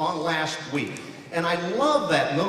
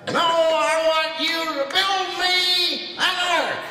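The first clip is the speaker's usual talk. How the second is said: much higher, slow booming voice; Bill Cosby as God; 11 semitones higher, slower than usual. Retold or imitated. God telling Noah to build an ark, slow and high. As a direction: stretch it out like a big storyteller. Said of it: "Bill Cosby did it".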